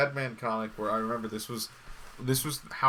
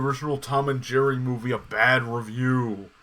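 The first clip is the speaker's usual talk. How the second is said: loud, hammed-up devil's accusation; Satan character; 2 semitones higher, 7 dB louder than usual. Satan accusing the critic over a bad review, loud mock voice. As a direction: boom out the charge. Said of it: "he has the Satan character".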